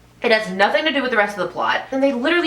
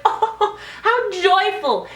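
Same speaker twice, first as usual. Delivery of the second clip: much higher, slow, sugary sarcasm; mock gushing; 9 semitones higher, much slower than usual. Mocking how the movie wants viewers to feel, high slow gush. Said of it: "You should be like".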